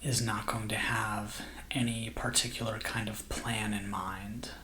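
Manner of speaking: soft, slow meditation voice with long pauses; guided meditation. Acted. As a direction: gently guide listeners into calm, unhurried and hushed.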